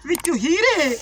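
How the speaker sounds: drawn-out, rising cries of treasure; excited invocation. Acted. Baba prays for riches before opening, excitedly calling.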